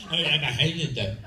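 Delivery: mock French-accented Highlander. Mocking The Highlander's casting, put-on French accent. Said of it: "a French accent".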